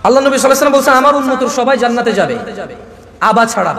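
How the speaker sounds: ordinary talk, nothing marked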